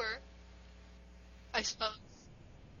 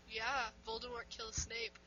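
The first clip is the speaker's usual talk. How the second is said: ordinary talk, nothing marked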